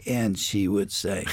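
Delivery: normal talking voice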